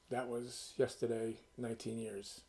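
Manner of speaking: ordinary delivery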